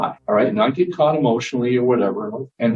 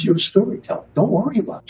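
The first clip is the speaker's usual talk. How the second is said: higher, reassuring nun voice; nun mentor; 10 semitones higher, faster than usual. Retold or imitated. Nun reassures student writer, higher, warm. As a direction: wave off his worries kindly. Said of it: "She goes".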